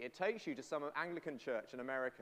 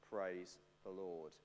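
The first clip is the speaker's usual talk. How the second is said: much lower, much softer and slow; hushed ending; 9 semitones lower, 9 dB quieter, much slower than usual. Acted. Preacher closing the verse softly to a hushed room. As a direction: let the words sink into a near whisper.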